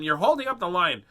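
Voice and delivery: imitating a pushy little girl; impatient girl. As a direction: nag like a kid in a queue. Girl voiced again.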